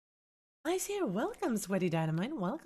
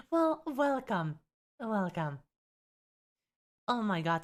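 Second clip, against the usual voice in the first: normal talking voice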